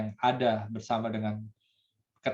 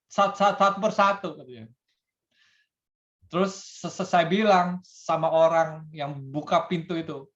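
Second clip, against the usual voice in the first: higher, stammering every syllable; hammed-up stutter; 4 semitones higher than usual. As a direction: force each word out through the stutter. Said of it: "yang gagap ini". (gagap berlebihan dengan nada tinggi)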